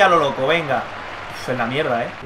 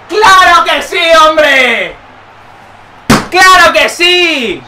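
higher, loud, drawn out; triumphant shout; 5 semitones higher, 5 dB louder, much slower than usual. Real, not acted. Streamer celebrating the winning goal, shouting. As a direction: stretch the claro in victory.

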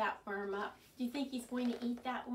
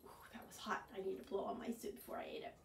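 breathy whisper after hot soup; 3 semitones lower, 9 dB quieter, much faster than usual. Acted.

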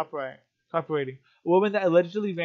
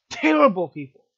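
louder burst on "terrible"; emotional outburst; 2 semitones higher, 8 dB louder than usual. Real, not acted. Condemning protesters to viewers, loud outburst. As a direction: hit "terrible" with real anger. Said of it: "get a little bit emotional".

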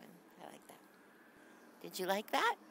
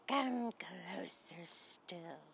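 slow, drawn-out, softer voiced "come closer still"; creepy spider voice; 3 semitones lower, 4 dB quieter, much slower than usual. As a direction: coax like a spider luring prey. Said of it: "She's saying".

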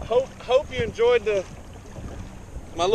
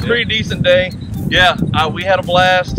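raised voice against the wind; shouting over wind; 7 dB louder than usual. Real, not acted. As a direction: call it out loud over gusts. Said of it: "Hopefully you can hear me over the wind".

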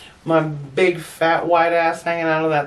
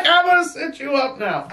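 much higher, called out like a nurse; high, raised calling voice; mock shouted nurse; 9 semitones higher than usual.

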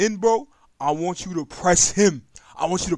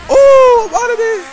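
startled shout; 14 dB louder, slower than usual. Real, not acted. Surprised by sudden attack.

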